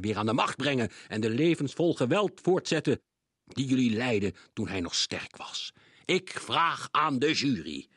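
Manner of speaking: high and loud, stern accusation; bellowing judge. A narrator's reading. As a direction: hammer each charge home to the court. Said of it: "brulde meneer Krenk". (luide, strenge aanklacht)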